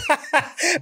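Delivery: short high burst of laughter; real laugh. Real, not acted. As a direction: laugh at the memory. Laughing at own story.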